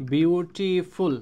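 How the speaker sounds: বিউটিফুল drawn out slowly in three syllables, বিউ-টি-ফুল; বিউটিফুল broken up slowly for learners. Shown as a demonstration. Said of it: "কয়বার উচ্চারণ হয়েছে".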